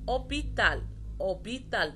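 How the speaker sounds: أوبيتال starting on a clean O, no H; French أوبيتال with silent H. Shown as a demonstration. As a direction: drop the H and open أوبيتال on the vowel. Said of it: "ما نطقتش القش خالص".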